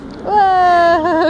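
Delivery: sudden delighted cry of whoa; excited whoa. Real, not acted. Child lands a jump.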